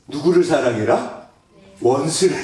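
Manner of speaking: ordinary talk, nothing marked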